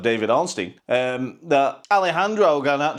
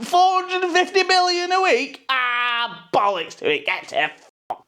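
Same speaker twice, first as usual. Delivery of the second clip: much higher, hammed-up voice of a player waving off a fortune; mock Bruno; 13 semitones higher than usual. Bruno telling Saudi to get lost, hammed-up high voice. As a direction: play Bruno scoffing at the Saudi money, pitched way up.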